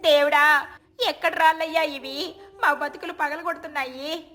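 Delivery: high, distressed village woman's lament; anguished wail. Acted. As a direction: wail to heaven in despair. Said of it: "గౌరమ్మలు ఆర్తనాదాలు వినిపించాయి".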